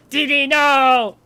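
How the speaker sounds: loud, high, drawn-out cry; stretched-out cartoon wail; panicked cartoon boy. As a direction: yell it like an exasperated cartoon genius. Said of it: "Dexter's Lab, uh, reference in there".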